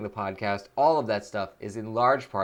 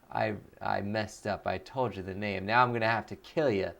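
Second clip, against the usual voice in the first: mock menacing voice; 5 semitones lower, 5 dB quieter than usual. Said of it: "Voice of Nick voice".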